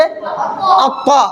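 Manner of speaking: अक्वा as a heavy, full-mouthed doubled emphatic t with rounded "aw"; thick heavy articulation. Shown as a demonstration. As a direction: thicken the consonant and vowel in अक्वा. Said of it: "मोटा करके पढ़ेंगे".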